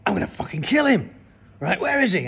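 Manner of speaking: a dad ready to fight; furious father. Retold or imitated. Enraged father hunting the man he suspects, loud and furious.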